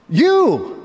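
YOU hit high and loud; You. said high and weighted; emphatic. Shown as a demonstration. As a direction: land hard on the word You. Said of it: "the emphasis there is emphatic".

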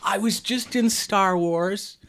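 high, loud put-on actor's voice; Peter Cushing. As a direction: boast theatrically to an old colleague. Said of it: "Peter Cushing was doing Star Wars".

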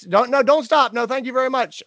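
high, embarrassed politeness; flustered polite refusal. Retold or imitated. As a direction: wave off help in a high fluster. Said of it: "I'm apologizing for my injury".

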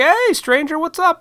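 high, bright mock-friendly hello; acquaintance's greeting. Imitating the acquaintance greeting him at the grocery store, high and chipper. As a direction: chirp it like an overly familiar acquaintance. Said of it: "They're like".

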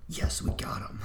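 hushed scammer whisper. Acted. Scammer voice in the prank call.

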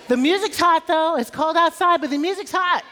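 high, loud and upbeat; excited. Real, not acted. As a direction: pump up the room. Energetic greeting to congregation.

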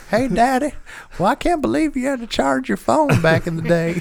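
put-on high little kid voice; squeaky child talking to dad. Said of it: "he'll come be like".

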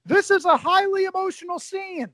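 a sly take on the ringside announcer; half-hearted announcer impression. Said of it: "I'm not going to try to do the accent".

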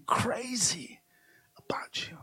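hushed whisper. Acted. Pastor whispering God's love to the church, hushed. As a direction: drop to a secret whisper.

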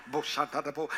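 a mock scat burst; scat like tongues. Singer scatting, teased as speaking in tongues. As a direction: rattle off nonsense syllables. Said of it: "Why you speaking in tongues?".

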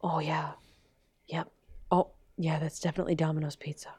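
quiet, fast, murmured stakeout voice; a lightly voiced hidden watcher; hushed spy voice. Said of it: "the guy watching him with binoculars".

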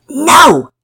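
put-on high, loud child's yell; yelled refusal. A narrator's reading. Pablo refuses to share with everyone, yelled out. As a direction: burst out with a defiant shout. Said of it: "Pablo yelled out".

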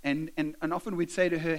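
normal talking voice

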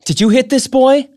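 high, loud accusing mother; loud, high demanding question. Acted. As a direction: confront the boy, demanding. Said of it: "his mother demanded".

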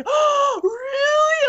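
excited. Retold or imitated. Boyfriend hearing she'll date him, thrilled. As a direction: burst out in thrilled surprise. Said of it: "he was all excited".

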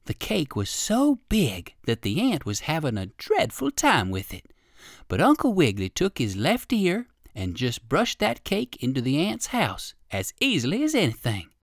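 ordinary delivery

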